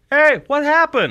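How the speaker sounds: high, loud, drawn-out "hey"; mock exclamation. Host voicing a puzzled viewer of the films, mock exclamation. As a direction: call it out loud and high, slowly.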